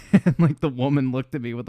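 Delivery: ordinary talk, nothing marked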